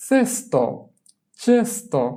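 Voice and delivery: চেস্তো high on চেস, falling on তো; pronunciation demo. Shown as a demonstration. Teacher dictates the Italian for basket, চেস্তো high and clearly sounded. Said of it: "আমি উচ্চারণ বলে দিব".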